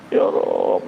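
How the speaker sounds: breathy mock sob on Ya Rabb; pretend crying. Shown as a demonstration. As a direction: fake a tearful plea to God. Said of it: "cak-cak nangis".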